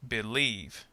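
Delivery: "Believe" with weak be, strong LIEVE; second-syllable stress. Shown as a demonstration. Teacher gives two-syllable verb example, "Believe" stressed on LIEVE. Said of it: "stress on the second syllable".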